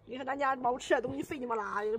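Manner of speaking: ordinary talk, nothing marked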